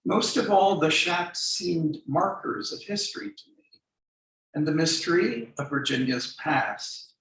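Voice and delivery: measured, deliberate poetry reading voice; poem reading. Acted. Poet reading his memoir poem to the audience, measured reading.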